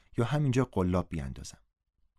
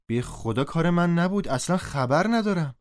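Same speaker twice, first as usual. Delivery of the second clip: higher, louder plea of denial; raised-voice denial; protesting innocence; 9 semitones higher, 5 dB louder than usual. Acted. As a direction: insist you had nothing to do with it. Accused narrator denies doing it.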